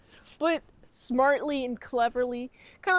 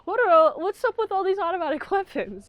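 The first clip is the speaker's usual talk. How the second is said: put-on higher, louder botched Scottish accent, cracking up; Sean Connery; 6 semitones higher, 5 dB louder than usual. Reviewer retrying the character's line, laughing mock Scottish accent, pitched up. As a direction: try the Scottish accent again and break into laughter. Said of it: "That's not him at all".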